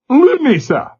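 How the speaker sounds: put-on high Glasgow accent, patient; patient Glasgow lad. A narrator's reading. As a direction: explain it patiently. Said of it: "McQuater explained patiently".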